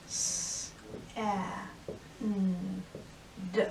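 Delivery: each sound slowly apart. Shown as a demonstration. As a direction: split sand into single sounds. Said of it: "teacher sounds out sand".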